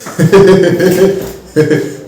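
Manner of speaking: real amused laugh; hearty chuckle. Real, not acted. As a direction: burst out laughing. Laughing at him.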